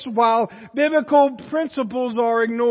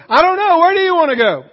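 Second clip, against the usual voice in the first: higher, louder evasive whine; mock evasive; 6 semitones higher, 7 dB louder, faster than usual. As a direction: toss the question back, playfully exasperating. Mock dodge of a question.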